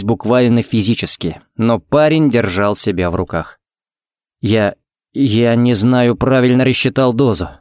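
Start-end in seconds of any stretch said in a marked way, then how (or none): none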